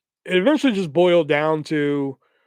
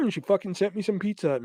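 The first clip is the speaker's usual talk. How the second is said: ordinary talk, nothing marked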